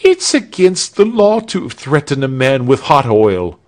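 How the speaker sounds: high, loud alarmed objection; indignant protest. A narrator's reading. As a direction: protest your rights to the torturer. Victim protests at the torture.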